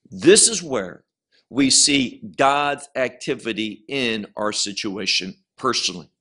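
ordinary talk, nothing marked

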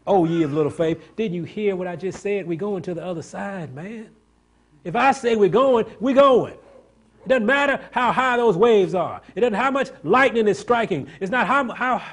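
rising to a high, loud preaching cry as Jesus; full-force preaching. Acted. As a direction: hammer it home like a revival preacher.

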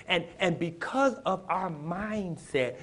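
ordinary talk, nothing marked

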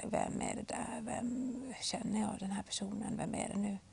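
a listener wonders who is calling, in a whisper; puzzled whisper. Pretend. Listener puzzled by unknown caller.

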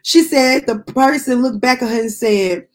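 ordinary talk

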